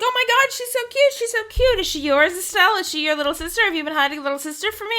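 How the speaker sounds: put-on high, fast, squealing gush over a cute girl; gushing excitement. Annalise gushing to Estelle about Tita, high excited squeal. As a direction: squeal it out like you just met the cutest kid ever.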